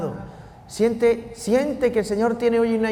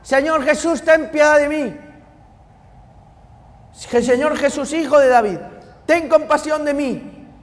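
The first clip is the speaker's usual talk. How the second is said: higher, louder pleading cry to Jesus; a beggar begging the passing Jesus for mercy, loud pleading cry; blind man crying out; 8 semitones higher, 5 dB louder than usual. Retold or imitated. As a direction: call out to him as a desperate beggar.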